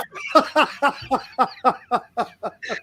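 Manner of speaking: real high, rolling laughter; long laugh. Real, not acted. Storyteller after the nightclub punchline, helpless laughing. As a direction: laugh on and on at your own punchline.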